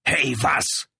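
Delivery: loud, high snapping retort; lieutenant snaps back at the protesting man; snapping at him. A narrator's reading. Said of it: "herrschte Tanny Brown ihn an".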